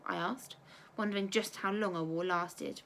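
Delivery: plain reading voice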